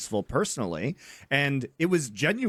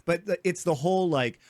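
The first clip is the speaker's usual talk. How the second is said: ordinary delivery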